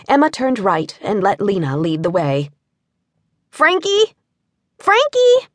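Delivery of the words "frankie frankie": high calling for the lost dog. A narrator's reading. Girl calling for her runaway beagle, high and loud. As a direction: sing out his name down the street. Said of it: "Lena called out".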